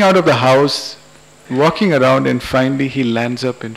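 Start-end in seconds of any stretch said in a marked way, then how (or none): none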